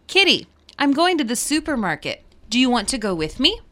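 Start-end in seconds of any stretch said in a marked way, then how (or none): none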